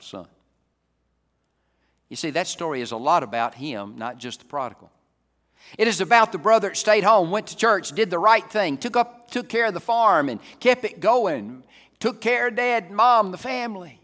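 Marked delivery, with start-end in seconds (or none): 5.8-14.0 very loud, high, punched list; shouted pulpit roll call; full-force preaching. Real, not acted. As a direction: hammer out each item to the congregation.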